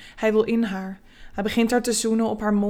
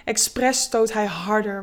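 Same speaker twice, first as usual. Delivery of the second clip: ordinary delivery, nothing marked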